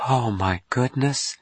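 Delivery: high, slow gasp of wonder; amazed. Acted. Dawn meets the man from Mars, amazed. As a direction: draw it out, awestruck.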